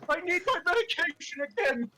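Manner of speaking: high, loud comic outburst; panicked shouting. Acted. Luigi demanding medication after hearing voices, frantic shout. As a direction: yell it in hammed-up desperation.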